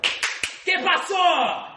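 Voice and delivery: high, drawn-out "¿Qué pasó?"; imitating boss. Mimicking the boss shouting in Spanish at work, high and slow. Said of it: "hits me in the chest, like".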